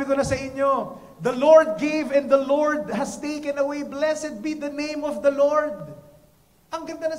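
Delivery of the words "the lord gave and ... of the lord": slow, loud, solemn recitation; solemn verse. Shown as a demonstration. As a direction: proclaim the scripture line. Quoting Job's verse.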